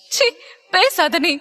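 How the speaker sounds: angry yell. A narrator's reading. Stella silencing Alex after slapping him, angry and high. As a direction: cut him off angrily. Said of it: "கோபத்தில் கத்திட".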